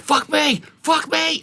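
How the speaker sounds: high mock shout; loud in-his-face rage. Promoter in heckler's face, imitated furious shouting. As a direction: bellow back in disbelief, imitating him. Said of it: "it was so loud".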